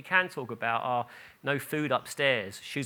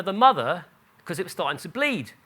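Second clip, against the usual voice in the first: ordinary delivery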